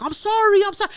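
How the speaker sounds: high, loud mock pleading; imitated frantic apology. Random guy apologising to the woman he insulted, pleading. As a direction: imitate a guy backpedalling fast.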